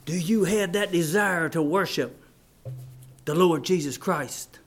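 ordinary talk, nothing marked

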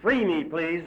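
usual speaking voice

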